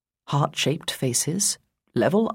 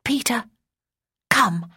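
whispered wish; 6 semitones higher than usual. Acted. Girl wishes with eyes shut.

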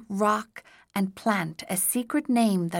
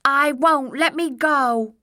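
goblin voice; 7 semitones higher, 6 dB louder than usual. A narrator's reading.

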